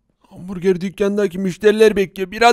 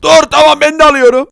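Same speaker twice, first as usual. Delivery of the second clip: higher and louder, rushing to agree; eager buyer; 5 semitones higher, 6 dB louder than usual. Acted. Villager calls to the seller so he is not left out, eager. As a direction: call out before you miss it. Villager hurries to buy too.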